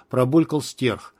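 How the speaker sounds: plain reading voice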